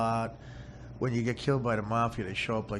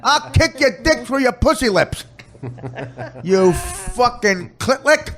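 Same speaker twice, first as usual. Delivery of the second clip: higher hammed-up cop tirade; cop talk; 14 semitones higher, 12 dB louder than usual. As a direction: build the insults into a ridiculous rant. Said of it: "They talk like cops".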